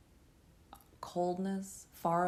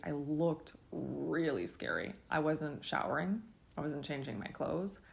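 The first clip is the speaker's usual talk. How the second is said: normal talking voice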